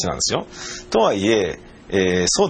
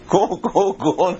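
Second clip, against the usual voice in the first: mock cop order; 9 semitones higher than usual. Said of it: "なんて".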